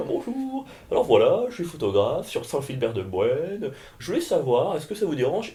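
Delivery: high, sing-song polite caricature; oblivious photographer. Acted. Photographer interrupting a comedian before the show, airily oblivious. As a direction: introduce yourself breezily, as if nothing were amiss. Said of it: "genre l'air de rien".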